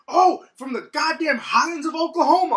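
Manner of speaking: imitating a loud, high-pitched jeer; mock scornful shout at full volume; scornful customer. Retold customer insulting the speaker.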